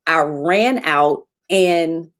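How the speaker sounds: usual speaking voice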